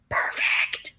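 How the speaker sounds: a pleased little whisper. Real, not acted. Whispering to the audience, quietly proud. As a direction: breathe the word out. Proud aside about her French.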